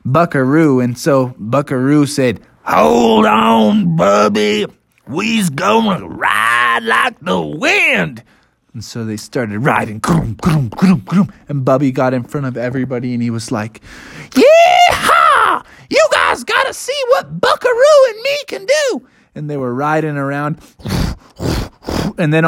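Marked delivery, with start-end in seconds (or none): 2.6-8.2 higher, stretched-out folksy creature; cowboy triceratops. Acted. Buckaroo warning Bubby before galloping, hearty. Buckaroo gets ready to run. 10.0-11.3 rhythmic rumbling hoofbeats; galloping noises. Acted. Storyteller imitating triceratops running, rhythmic rumbles. 14.3-19.1 very high, shouted little-bear voice; exhilarated Bubby. Acted. Bubby showing off on Buckaroo, exhilarated.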